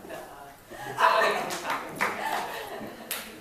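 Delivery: laughing, whispery high brush-off; laughing refusal. Retold or imitated. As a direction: wave him off through a laugh. Friends refusing the singer.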